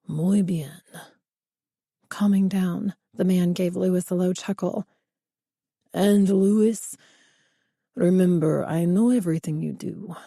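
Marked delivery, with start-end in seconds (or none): 0.1-0.8 put-on low, slow menacing Spanish accent; a threatening boss in thick Spanish accent. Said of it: "A heavy Spanish accent". 5.9-10.2 put-on a threatening boss in thick Spanish accent. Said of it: "A heavy Spanish accent".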